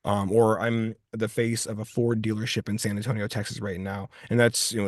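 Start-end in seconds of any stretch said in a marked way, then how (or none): none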